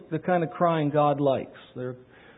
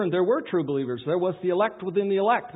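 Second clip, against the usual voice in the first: ordinary talk, nothing marked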